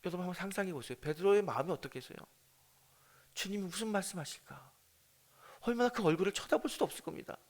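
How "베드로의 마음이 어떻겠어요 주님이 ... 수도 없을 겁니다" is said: ordinary talk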